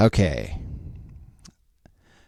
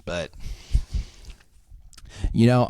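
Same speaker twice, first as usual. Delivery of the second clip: ordinary talk, nothing marked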